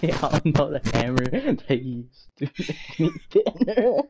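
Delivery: slow, laughter-broken words; real laugh breaking up a slow sentence; laughing hard. Real, not acted. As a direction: struggle to get the line out through laughter. Cracking up repeating the line.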